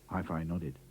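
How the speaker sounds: ordinary delivery, nothing marked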